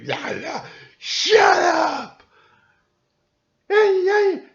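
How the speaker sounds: high, loud mocking shout of shut up; mock yelling host. Mocking a talk show host who yells the loudest, hammed-up shout. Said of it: "see who can yell the loudest".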